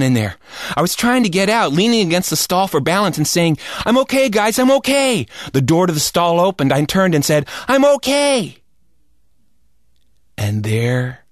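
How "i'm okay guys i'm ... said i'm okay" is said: higher, breathless I'm okay; boy assuring friends, then teacher; sheepish reassurance. Retold or imitated. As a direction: insist you're fine while stuck. Boy stuck in toilet reassures.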